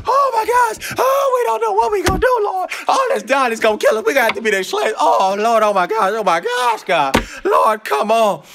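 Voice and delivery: hammed-up terrified cries to the Lord; panicked soldiers. Soldiers flee Goliath, hammed-up panicked shouting.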